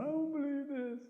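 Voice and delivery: high, soft trailing wail; small, far-off play-acted whine; mock whimper. A narrator's reading. Possum left behind on the road, whimpering. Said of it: "Possum's going".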